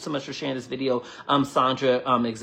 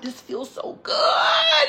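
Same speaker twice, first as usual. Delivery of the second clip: very high, slow, squeaky gush; gushing about her crush; swooning; 4 dB louder, much slower than usual. Retold or imitated. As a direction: squeal it like a smitten teen. Retelling swooning over her crush.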